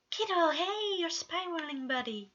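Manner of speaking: caring dad calming anxious friend; gentle interruption. A narrator's reading. Patton calming Virgil.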